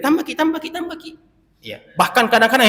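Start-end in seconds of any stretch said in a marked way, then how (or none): 0.0-1.1 much higher, coaxing host voice inviting to eat; mock Bugis host urging, much higher; imitating a host. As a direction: coax the guest to eat more, high and sweet. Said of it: "tidak bilang".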